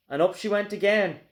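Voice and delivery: ordinary delivery, nothing marked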